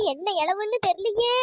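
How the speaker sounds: ordinary delivery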